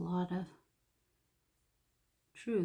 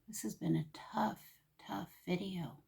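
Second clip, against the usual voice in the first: hushed, near-whispered, fighting emotion; holding back tears; 2 semitones higher, 8 dB quieter than usual. Real, not acted. To her viewers, fighting tears in a hushed voice. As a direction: barely get it out, trying not to cry. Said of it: "I'm trying not to get emotional".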